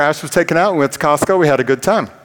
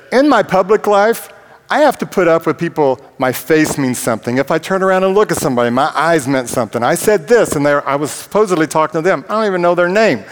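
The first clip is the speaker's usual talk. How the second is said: retold rant; 5 semitones higher than usual. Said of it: "And I said".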